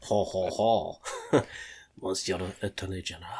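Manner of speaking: put-on low, soft French-accented chuckle and address; mock French gentleman's accent; bad French accent. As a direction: attempt a suave French accent. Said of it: "Dan do this in his French accent".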